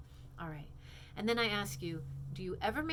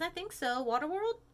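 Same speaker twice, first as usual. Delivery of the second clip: put-on high, hopeful girl voice; Peach voice; 9 semitones higher, 4 dB louder than usual. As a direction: Peach answering, high and perky. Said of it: "And Peach says".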